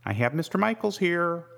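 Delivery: high, chirpy secretary voice; chirping. A narrator's reading. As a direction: chirp it lightly, pitched well up. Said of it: "she chirped".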